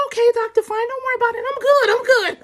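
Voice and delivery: high, squealed dismissal; hammed-up high-pitched reassurance; breezy brush-off. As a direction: wave the doctor off in a high, loud voice. Acts out brushing off the doctor.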